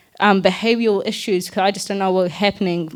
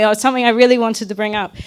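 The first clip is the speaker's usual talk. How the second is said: ordinary delivery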